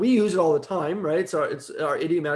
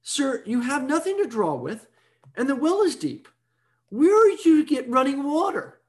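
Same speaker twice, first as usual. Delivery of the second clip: higher-pitched, skeptical questioning; challenging woman; 7 semitones higher than usual. Acted. Samaritan woman challenging Jesus at the well, skeptical. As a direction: lightly act her challenge, pitched up. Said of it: "She says".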